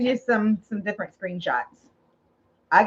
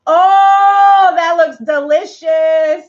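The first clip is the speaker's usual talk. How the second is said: excited; 4 semitones higher, 7 dB louder, much slower than usual. Real, not acted. Tasting a delicious sauce.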